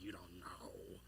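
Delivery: very low, hushed retort; dismissive whisper. Retold or imitated. Disciples dismissing the eyewitness, scornful whisper.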